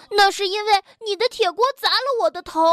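high, rapid, unyielding protest; defiant comeback. A narrator's reading. Tiaotiao blames Bengbeng's iron pan, defiant. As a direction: throw it straight back, refusing to lose. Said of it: "跳跳不甘示弱".

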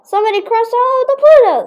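high, loud story-book shout. A narrator's reading. Stink finding Pluto crossed out in the books, shouting. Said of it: "shouts Stink".

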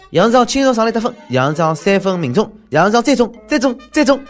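high, loud sports commentary; excited play-by-play. Acted. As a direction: call the baskets like a hyped announcer. Mock play-by-play of mayor scoring.

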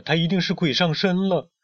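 high, astonished storybook cry; alarmed exclamation. A narrator's reading. Friend thinks the hen is possessed, alarmed. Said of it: "贝里奥惊呼".

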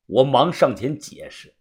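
ordinary delivery

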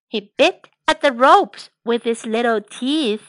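ordinary delivery

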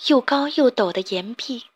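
usual speaking voice

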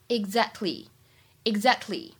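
"exactly" slow, the t barely released; partly unreleased t. Shown as a demonstration. Teacher showing the soft t in "exactly", slow. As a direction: soften the t in "exactly", slowly. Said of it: "不完全失去爆破".

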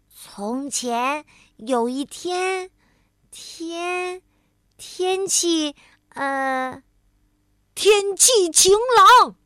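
slow, stumbling word-by-word reading; halting reading. A narrator's reading. Tiaotiao learning to read aloud, halting. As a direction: sound it out, then loudly finish.

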